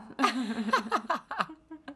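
lopsided laugh. Real, not acted. Laughs at own numb face.

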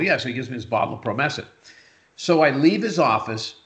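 usual speaking voice

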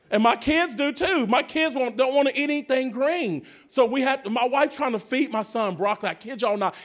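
ordinary delivery

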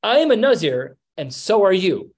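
loud, high emphatic declaration; telling, not asking. Acted. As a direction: lay it down as a fact, loudly. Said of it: "He's telling her".

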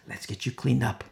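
"Let's get you cleaned up" said softly; soft, understated reassurance; gentle offer. Retold or imitated. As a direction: offer help quietly. First take of gentle offer.

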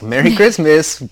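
mock Santa greeting.

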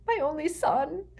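high moaning cry; sobbing. A narrator's reading. Mother crumpled at Snape's feet, sobbing. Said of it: "sobbing and moaning on the floor".

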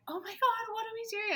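very high, breathless oh my God; thrilled and flustered at once; giddy panic. Retold or imitated. Couple at night with a positive test, giddy and overwhelmed. Both overjoyed at 3 am.

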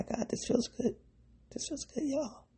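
high, hushed delighted squeal; breathless high-pitched delight; thrilled. Real, not acted. Thrilled at her own insight.

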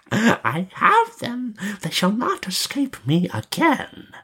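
high, slow, gloating witch voice; mocking witch. A narrator's reading. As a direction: gloat mockingly over captured prey. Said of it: "said mockingly".

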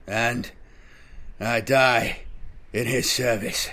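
strained words with long gaps; dying, halting. A narrator's reading. Dying sergeant completing his rite, halting and strained. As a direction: push each phrase out with failing strength.

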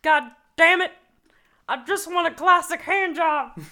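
put-on loud, high, exasperated old-timer yelling; mock furious outburst from an older man; frustrated boomer rant. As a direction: bellow it like a fed-up boomer who can't find his porn. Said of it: "someone being like".

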